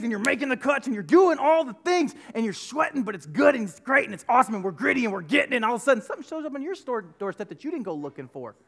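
usual speaking voice

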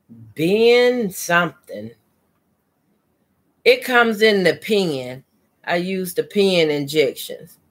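usual speaking voice

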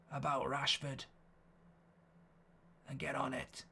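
put-on hushed Irish accent, muttering a breakfast plan; quiet Irish voice talking to yourself. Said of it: "and I was going".

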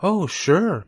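a gentle hint of a wise spider, hesitant; Charlotte the spider.

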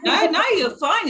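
high, loud laughing "no, no"; laughing reassurance. Real, not acted. Guest laughs off the host's accent guess, reassuring. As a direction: laugh it off to put her at ease.

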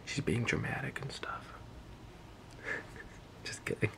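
teasing whisper. Real, not acted. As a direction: whisper it behind her back. Teasing friend while she showers.

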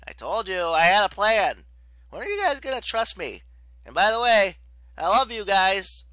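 high, loud, urgent send-off; shouted farewell. A narrator's reading. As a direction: shout a last goodbye over the flames. Leo shouts farewell while burning.

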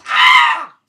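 sudden high, very loud scream. Real, not acted. Player failing and having to retry, screaming. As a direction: scream out at the setback.

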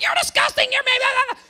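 high mock yell of an angry brother; a brother scolding over a week-old cereal bowl, mocked in a high voice. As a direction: squeak it out like a nagging brother. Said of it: "He's like".

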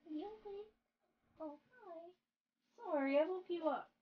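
tiny, high, hushed voice; soft cooing. Real, not acted. Streamer apologizing to a pet just woken, soft cooing. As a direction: coo gently to someone waking up.

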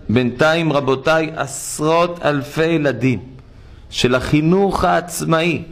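usual speaking voice

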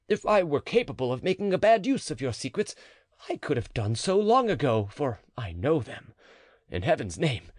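mild hammed-up despair; desperate marquis. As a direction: beg her as a frantic lover. Marquis begging the matron.

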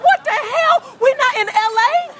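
high, loud, half-laughing shock; shocked yell. Retold or imitated. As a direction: cry out in disbelief. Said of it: "I was like".

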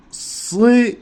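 "sı" with a clear back ı, not "si"; plain sı not si. Shown as a demonstration. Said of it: "şimdilik sı deyin". ("sı" kalın ı ile, "si" değil)

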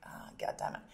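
unvoiced mutter under the breath; whispered curse. She whispers her grudging reaction to Keith's advice.

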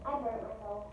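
quiet, to herself; soft, fading complaint. Real, not acted. Repeated to herself.

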